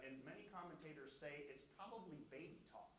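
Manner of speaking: ordinary delivery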